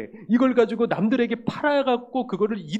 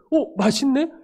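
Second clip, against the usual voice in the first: surprised delight; 7 semitones higher, 7 dB louder, slower than usual. Retold or imitated. Skeptical customer surprised by taste.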